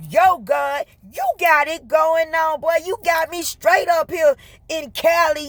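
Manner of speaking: high-pitched playful excitement; hyped buddy talk. Retold or imitated. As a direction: hype up God like a best friend.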